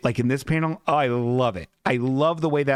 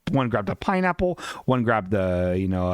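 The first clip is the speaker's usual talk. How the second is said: usual speaking voice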